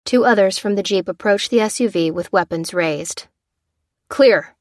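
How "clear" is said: loud clipped call; shout. Acted. Soldier shouting the all-clear to his squad, loud. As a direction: call it out across the car. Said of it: "shouted the foremost man".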